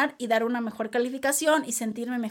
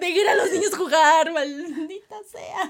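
mock tearful protest; 3 semitones higher than usual.

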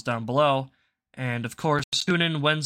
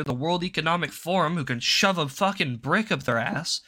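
ordinary talk, nothing marked

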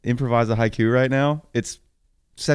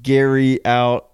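slow, louder Gary out; haiku line; 3 semitones higher, 7 dB louder, much slower than usual. Pretend. Finishing the haiku for his co-host, slow and loud. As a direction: punch out Gary out as the haiku ending. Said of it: "three syllables left after Gary".

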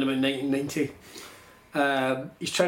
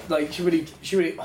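ordinary talk, nothing marked

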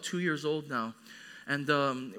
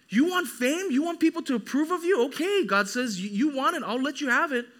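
high, loud voice of God challenging people; forceful preaching; 9 semitones higher, 5 dB louder than usual. Acted.

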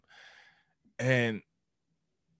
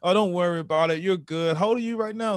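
imitated professor; 6 semitones higher, 3 dB louder, faster than usual. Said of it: "I call my professor".

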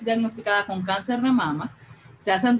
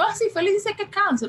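much higher-pitched, airy casual reply; breezy nonchalance; 10 semitones higher than usual. Retold or imitated. Telling her sister the diagnosis like a flu, breezily nonchalant. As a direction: toss it off like minor news. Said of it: "como que yo tengo gripe".

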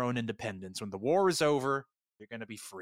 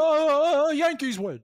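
mock scream; 11 semitones higher, 6 dB louder, much slower than usual.

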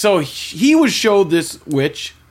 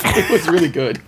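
ordinary talk

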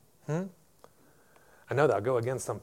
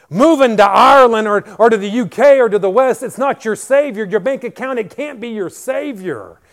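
full-force preaching; 8 semitones higher, 13 dB louder than usual. Acted. Pastor to church, proclaiming God's words at full force. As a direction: proclaim every line like thunder to the church.

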